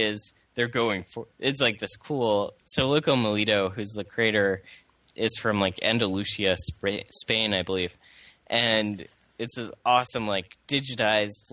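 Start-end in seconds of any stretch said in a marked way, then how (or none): none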